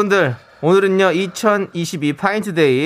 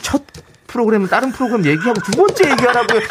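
mock scolding; 6 semitones higher than usual. Host scolds partner in mock anger.

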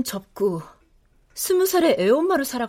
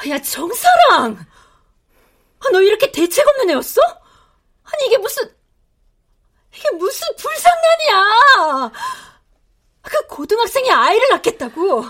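much higher, loud tearful yelling; furious shrieking; 9 semitones higher, 4 dB louder than usual. Acted. Mother screams at her daughter over the pregnancy, furious and tearful.

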